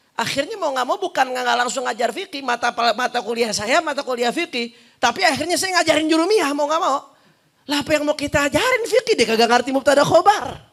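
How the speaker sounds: high-pitched, worked-up preacher; exasperated teacher making a point; animated, exasperated lecturing. Real, not acted.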